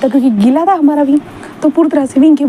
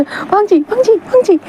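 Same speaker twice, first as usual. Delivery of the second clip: high, frantic calling of a name; panicked call; 9 semitones higher than usual. Acted. (घबराकर ऊँची आवाज़ में पुकारना)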